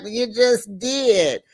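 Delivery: high, drawn-out call-out; mock exclamation. Vlogger quoting cast catching an interrupter, mock exclamation. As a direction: stretch it out in mock disbelief. Acts out cast calling her out.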